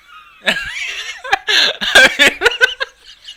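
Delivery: loud, squealing burst of laughter; real high cackle of disbelief; high shrieking laugh. Real, not acted. Joke about paying rent.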